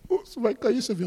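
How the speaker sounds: very high, whimpering mock plea; hammed-up tearful question; imitating near-tears passenger. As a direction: beg as if about to cry. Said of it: "ela tava quase chorando".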